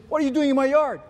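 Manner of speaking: sharp loud shout at the intruder; angry yell. Acted. Neighbor yelling at man in his yard, angry.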